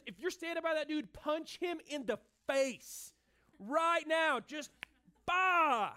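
furious high priest. Acted. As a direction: order the punch, then explode. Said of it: "The high priest is so mad".

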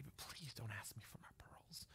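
soft, unvoiced begging; pleading whisper. Daughter begging her father not to take her pearls. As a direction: whisper the plea like a scared child.

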